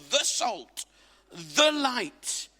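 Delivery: THE stressed before salt and light. Shown as a demonstration. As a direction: lean hard on each "the". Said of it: "you are definite article".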